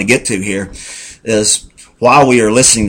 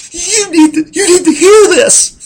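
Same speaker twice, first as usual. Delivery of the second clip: high, put-on wife voice; imitating his wife; 5 dB louder than usual. Husband imitating his crying wife, high pitched. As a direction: mimic her in a high voice. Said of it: "she looks at this tape and says".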